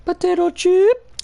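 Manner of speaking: very high, loud squeaky voice. Pretend. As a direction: squeak it out like a cartoon. Silly mic test squeak.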